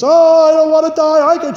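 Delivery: high, loud, terrified cry of a dying woman; frightened wail. Retold or imitated. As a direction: wail it out like she's begging not to die. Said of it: "she was just crying out".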